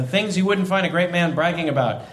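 ordinary talk, nothing marked

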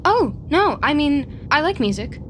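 flustered backpedal. Real, not acted. Told silence is psychopathic, flustered backpedal. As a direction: quickly insist you're normal.

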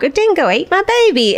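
put-on high, playful kid's taunt of the dingo joke; singsong playground joke voice; mock Aussie joke line. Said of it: "we used to say this joke".

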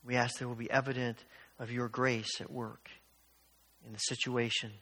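low, soft spoken prayer. Real, not acted. Pastor praying aloud as a siren passes, soft and low. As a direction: pray gently for strangers in need.

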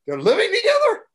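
high-pitched incredulous mimicry; scandalized, squealed question; shocked disbelief. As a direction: shoot the pitch way up in mock astonishment. Retold shocked reaction to news.

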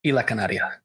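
'Isla Canaria' said fast and smooth, Spanish vowels, r tapped; native Spanish pronunciation. Shown as a demonstration. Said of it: "You have to say it really quickly".

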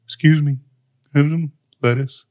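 lightly put-on polite dog voice; Sadie. Host attempting a pet's voice for the co-host, lightly put-on character. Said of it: "That's Sadie".

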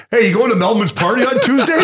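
high, mock-excited question; eager party invite. Imitates pal eagerly asking golf star.

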